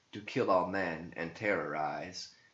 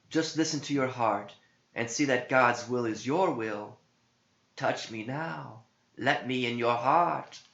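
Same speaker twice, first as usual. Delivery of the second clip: high, breathy-free temptress voice pitched up; high seductive tempter voice; seductive demon; 6 semitones higher, 3 dB louder than usual. Acted. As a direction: coax him like a tempting spirit.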